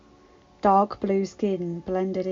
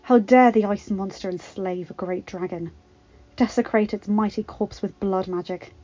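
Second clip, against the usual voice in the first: plain reading voice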